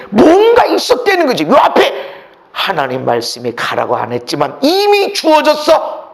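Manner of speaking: ordinary delivery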